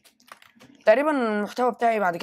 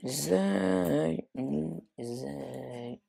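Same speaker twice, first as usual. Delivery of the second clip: defeated mumble; 3 semitones lower, 6 dB quieter, much slower than usual. Real, not acted. Defeated after dying.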